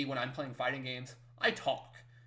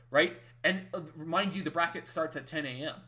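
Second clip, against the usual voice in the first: ordinary talk, nothing marked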